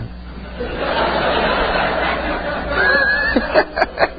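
long breathy burst of laughter at the memory; hearty laugh. Real, not acted. Preacher laughing at his own strange encounter, breathy laughter. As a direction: laugh it off, wheezing with amusement.

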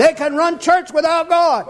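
high, strained pulpit delivery; full-force preaching. Real, not acted. Preacher urging his congregation, high-pitched full-force preaching. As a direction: preach it in a high, driving cry.

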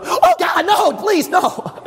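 high-pitched hammed-up "oh no" plea; playfully acted horror; mock panicked pleading. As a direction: beg in exaggerated dismay, voice shooting up. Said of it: "you and I would go".

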